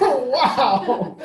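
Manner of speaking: high, giggling laugh through the words. Real, not acted. Speaker laughing at adviser's excitement over a card, high giggle. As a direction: burst out laughing at the memory.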